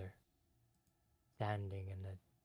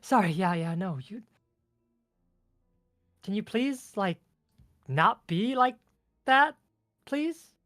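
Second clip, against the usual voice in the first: high, loud fairy voice; apologise, then plead in a high fairy voice; flustered ice fairy; 10 semitones higher, 14 dB louder than usual. Acted.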